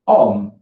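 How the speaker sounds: HOMME said the French way, starting on the vowel; silent H. Shown as a demonstration. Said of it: "the H is silent".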